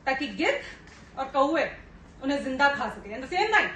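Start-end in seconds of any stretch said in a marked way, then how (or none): none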